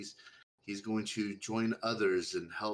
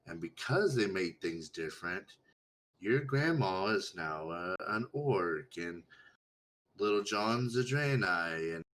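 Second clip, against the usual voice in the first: put-on reading to children; 2 semitones lower than usual. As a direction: tell it like a bedtime story. Storytelling to simple character.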